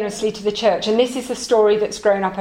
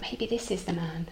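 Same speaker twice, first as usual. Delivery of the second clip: much softer, to himself; hushed thought; 3 semitones lower, 13 dB quieter than usual. Acted. Tinker hopes the shopkeeper is the one, hushed inner voice. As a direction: breathe the hope under your breath.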